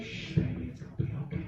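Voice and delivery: hushed, tender cooing; mock lullaby voice; soothing whisper to baby kangaroo. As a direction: shush it like calming a baby.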